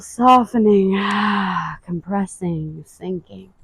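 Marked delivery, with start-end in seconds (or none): 1.0-1.8 sighing breath out; audible exhale. Shown as a demonstration. Guide models the exhale for the class, sighing.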